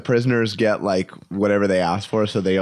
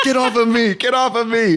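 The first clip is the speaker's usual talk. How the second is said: higher, laughing mock guard; imitating guard; 12 semitones higher, 4 dB louder than usual. Guard shaking a tourist off his leg, laughing mock order. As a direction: shove off the clinging tourist, laughing. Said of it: "He's like".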